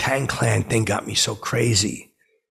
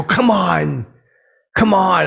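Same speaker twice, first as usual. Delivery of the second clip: loud, high "come on" coaxing; retold pleading; 8 semitones higher, 6 dB louder, slower than usual. Fan pestering a reluctant drummer, loudly pleading. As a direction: push them insistently, raised and high.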